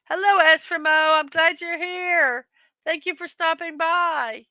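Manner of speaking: light up for a new arrival; cheerful welcome to a viewer popping into the chat; happy greeting. Real, not acted. Greeting another viewer joining.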